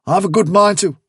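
high, loud indignant burst; angry threat. Acted. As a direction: threaten until cut short. Bigwig threatens, gets cut off.

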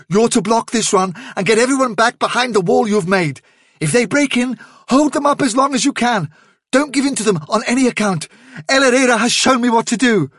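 high, pressing leader's orders; Hazel, urgently commanding. A narrator's reading. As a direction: rattle out orders with no time to lose.

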